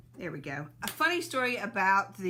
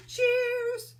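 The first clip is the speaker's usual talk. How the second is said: cheerful toast; 6 dB louder than usual. Real, not acted. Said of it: "let's do some cheers".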